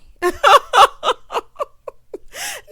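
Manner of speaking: high, loud burst of laughter; laughing out loud. Real, not acted. Commentator cracks up at the dad's comeback, loud laughing.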